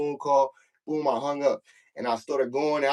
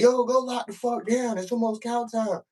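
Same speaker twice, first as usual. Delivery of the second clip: mock yell, pitched way up; imitated yelling; 11 semitones higher than usual. Officer yelling at inmates through the slot, mock yell. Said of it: "be yelling through the slot".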